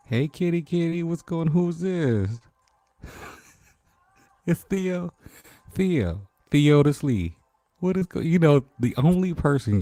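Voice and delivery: loud, high-pitched delighted surprise; excited recognition. Real, not acted. Streamer spotting friend Theo in the chat, excited.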